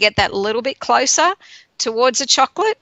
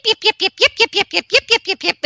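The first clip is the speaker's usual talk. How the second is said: yappy mouthy mother; 10 semitones higher than usual. Retold or imitated. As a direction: yip nonstop like a mouthy mother. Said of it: "nonstop mouthy mother".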